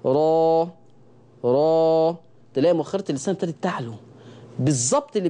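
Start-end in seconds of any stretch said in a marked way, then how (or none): 0.0-2.2 را thick and open; heavy ra. Shown as a demonstration. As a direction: demo را with raised tongue back. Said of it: "لو مثلت إن دي مؤخرة لساني".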